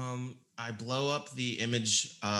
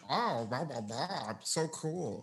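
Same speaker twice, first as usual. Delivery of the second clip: mock dragon voice; 5 semitones higher, slower than usual. Player voicing the dragon as it brags. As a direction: toss it off like a bored dragon. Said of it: "I have the dragon going like".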